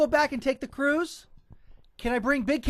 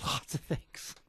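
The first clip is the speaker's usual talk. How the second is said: whispered; 9 semitones lower, 11 dB quieter, slower than usual. Real, not acted. Unvoiced mumble.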